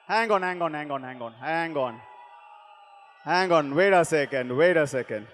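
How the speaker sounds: quiet the room down gently; hushed calming. Real, not acted. Hushing crowd.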